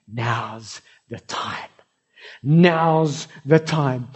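very slow, drawn-out words; God declaring the moment has come; slow, solemn declaration. Acted. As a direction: spell out each word with weight. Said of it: "God is saying".

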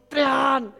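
loud, high barked command; sergeant major's shout. Retold or imitated. Sergeant major yelling at new troops, barked shout. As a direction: bark it like a drill sergeant. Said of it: "shouts at the people".

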